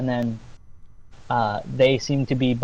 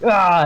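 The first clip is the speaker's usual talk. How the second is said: short, raised mock war cry; acted battle yell; 6 semitones higher, 6 dB louder than usual. Lumberjacks raise their axes, acted battle yell. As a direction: yell as if lifting an axe. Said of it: "they raise their ar-, axe like".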